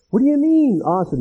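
sudden high disbelief; surprised. Retold or imitated. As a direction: catch on the word in surprise. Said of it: "Paul answers Jesus".

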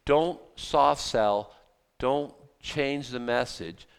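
each word slow and weighty; slow emphatic. Real, not acted. Preacher warning the congregation not to soften the message, slow. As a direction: lay it down slow so it sticks. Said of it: "I'm just trying to say".

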